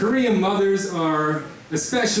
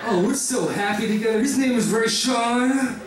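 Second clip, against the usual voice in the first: higher, giddy taunt at mom, laughing; gleeful taunt; 5 semitones higher than usual. Retold or imitated. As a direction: rub it in with a giddy grin. Son gleefully provoking his mother.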